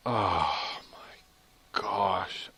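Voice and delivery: slow, breathed-out sigh; dismayed whisper. Real, not acted. Player finding the lost levels, slow whispered dismay. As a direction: let it out softly, drawn out. Finds most levels gone.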